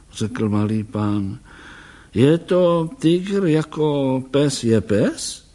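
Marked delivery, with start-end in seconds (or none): 2.1-5.4 slow, higher puzzled question; curious old doctor. Acted. Doktor se zvídavě ptá. (zvídavý starý pán, pomalu)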